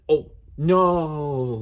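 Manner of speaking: high, drawn-out "no" of dismay; real dismay. Real, not acted. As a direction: cry out as the damage sinks in. Discovers another broken piece.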